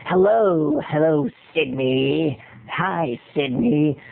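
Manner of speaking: low, slow phone terrorizer; deep, drawn-out stalker greeting; audition villain voice. Shown as a demonstration. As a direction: creep down low as the menacing caller. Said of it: "picked up the script and went".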